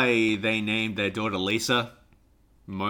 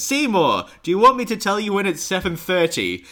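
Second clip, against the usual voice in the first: mum calling; 7 semitones higher, 3 dB louder than usual. Retold or imitated. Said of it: "his mum shout from downstairs".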